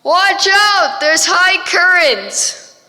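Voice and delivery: high, loud warning shout; yelling warning. Acted. Lighthouse keeper shouting a warning to the rowing man. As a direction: call out across the water urgently. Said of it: "someone on the lighthouse yelling".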